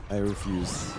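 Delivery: low villain voice, lightly held; menacing refusal in evil voice. Acted. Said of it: "Just stop it".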